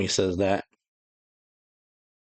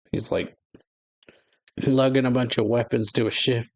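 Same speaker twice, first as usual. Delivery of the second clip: ordinary delivery, nothing marked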